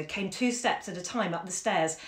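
usual speaking voice

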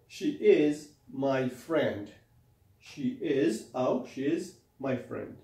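slow teaching demo. Shown as a demonstration. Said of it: "teacher models sentence slowly".

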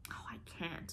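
hushed, near-whispered regret; sorrowful. A narrator's reading. Anne faces the end of Redmond life, sorrowful. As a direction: let it out softly and sadly. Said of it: "said Anne sorrowfully".

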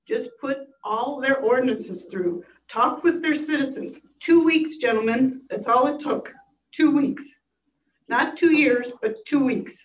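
rising to a loud, upset plea. Real, not acted. Citizen pleading loudly with commissioners for safety ordinances. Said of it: "I'm getting upset".

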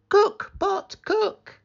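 very high chanting character voice; high-pitched spell command; magic command. Acted. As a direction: call out the magic words high. Magic words acted out.

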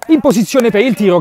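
loud rise for the shot; excited call. Real, not acted. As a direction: lift the voice as he shoots. Shot building up. (telecronaca concitata)

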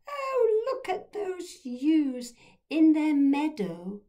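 very high, slow, gushing posh aunt. A narrator's reading. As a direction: swoon over the meadow in a loud posh voice. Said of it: "posh voice".

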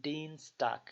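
"দিনস্টাগ" with a long drawn ee vowel. Shown as a demonstration. Tutor demonstrating the long vowel to students. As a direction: pull out the ee in "দিনস্টাগ". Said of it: "লম্বা করে টান দিতে হবে".

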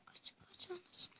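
urgent hushed whisper; whispered panic. Real, not acted. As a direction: whisper it while fleeing. Fleeing a mob.

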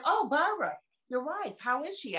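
bright and high on "Oh, Barbara", then easing down; cheery greeting. Retold or imitated. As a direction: greet him like a delighted old friend. Friendly greeting at the store.

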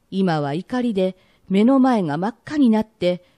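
normal talking voice

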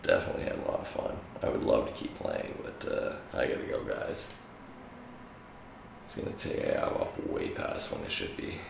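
tired, raspy late-night voice; hoarse and worn out from a long night. Real, not acted. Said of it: "As you can hear about my raspy ass voice".

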